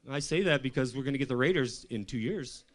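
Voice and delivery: ordinary talk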